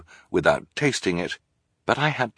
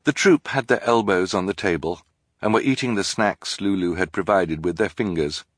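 ordinary delivery, nothing marked

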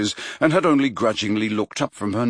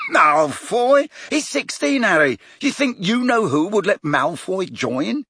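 much higher, louder incredulous Ron; scoffing disbelief; 11 semitones higher, 3 dB louder than usual. A narrator's reading. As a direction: laugh the idea off. Ron erupted in laughter.

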